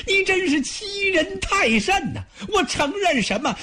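高亢尖声的怒喊，带着哭腔; 气到极点，声音拔高到破音; furious outcry. Acted. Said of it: "把许褚气的". (许褚被许攸逼问，气得高声哭喊)

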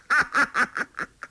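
high, staccato villain's laugh; long cackle trailing away; 得意大笑. A narrator's reading. 欧阳锋得意大笑. (尖声连串大笑)

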